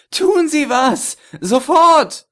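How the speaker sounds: high, loud, slow desperate yell; panicked scream. A narrator's reading. Chess in Panik. (panischer Schrei)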